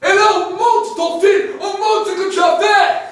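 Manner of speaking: loud, high booming proclamation; preaching at full force. Acted. Preacher describing heaven's throne to the congregation, loud. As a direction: shout the glory of heaven to the crowd.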